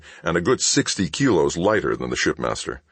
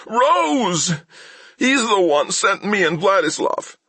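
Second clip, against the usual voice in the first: high, louder pained confession; whimpering; 4 dB louder than usual. A narrator's reading. Thief confessing to Mal, high whimpering voice. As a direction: spill it out in pain. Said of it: "speak up".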